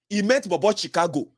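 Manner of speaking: normal talking voice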